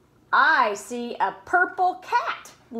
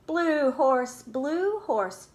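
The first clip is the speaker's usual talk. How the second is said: ordinary delivery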